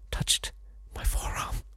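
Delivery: hushed, breathless whisper. A narrator's reading. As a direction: whisper it like a secret thrill. Reader whispers a tender moment.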